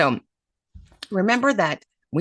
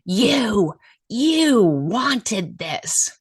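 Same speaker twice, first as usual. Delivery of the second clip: accusing teacher; 6 semitones higher, 3 dB louder, much slower than usual. Retold or imitated. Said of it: "she just looks at me and she goes".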